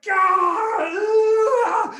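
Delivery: long, high, loud howl; drawn-out cry of rage; furious. Acted. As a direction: scream it out in hammed-up fury. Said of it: "he's so furious".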